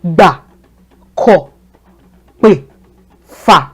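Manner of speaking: "Ba, ko, pe, fa" each on a low, falling tone. Shown as a demonstration. Teacher drilling low-tone syllables for students. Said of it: "the do tone".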